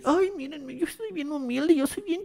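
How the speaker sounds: high, soft smug humble-brag; mock him as a fake humble star; mocking show-off. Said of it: "querer andar de". (burla presumida aguda)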